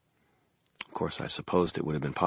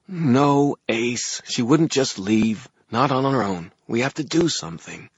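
higher, louder woman's voice with understated weariness; tired woman; 8 semitones higher, 5 dB louder, slower than usual. A narrator's reading. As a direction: voice a weary woman, pushing her point. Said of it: "The woman's voice sounded tired".

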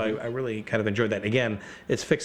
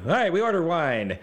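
high, chirpy player reply; eager players; 7 semitones higher, 3 dB louder than usual. Acted. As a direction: answer gamely, pitched up. Players answering the game master.

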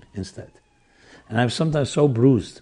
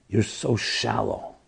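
contemptuous put-down; 2 semitones lower, 6 dB quieter, much slower than usual. Retold or imitated. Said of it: "looked at her with his dirty look".

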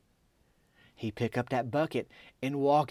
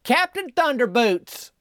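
slow, higher, stretched out holler of joy; 9 semitones higher, slower than usual. Retold or imitated. Old lady naming her new goldfish, slow delighted holler. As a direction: call the name out like a happy old lady. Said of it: "Doreen just hollers".

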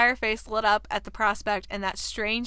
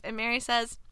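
normal talking voice

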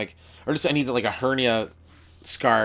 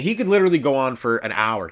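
ordinary delivery

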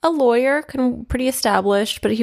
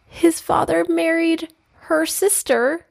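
rising outrage; 10 semitones higher, slower than usual. Real, not acted. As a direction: pitch climbing higher with disbelief. Outrage at the father's remarriage.

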